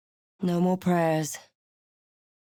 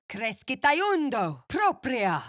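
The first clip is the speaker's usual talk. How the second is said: slow, higher, shouted spell; shouted incantation; 6 semitones higher than usual. Acted. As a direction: call the spell out slowly and forcefully.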